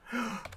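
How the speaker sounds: quick high gasp of a sudden idea; sharp gasp. Real, not acted. Sudden idea while building.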